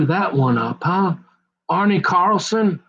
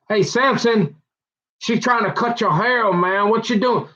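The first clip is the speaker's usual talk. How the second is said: higher, urgent buddies calling out; warning friends; 6 semitones higher than usual. Retold or imitated. Friends warn Samson about his hair, higher and urgent. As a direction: warn him like a worried pal. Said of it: "everybody's saying".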